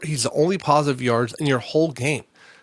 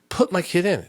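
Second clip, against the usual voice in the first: higher, mildly insistent retold demand; 8 semitones higher, 3 dB louder than usual. Parent to coaches during a losing game, retold demand. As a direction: replay a sideline demand, pitched up. Acted sideline argument with coaches.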